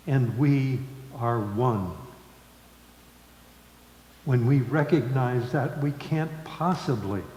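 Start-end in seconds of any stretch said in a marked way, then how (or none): none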